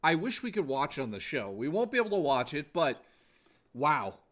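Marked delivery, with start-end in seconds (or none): none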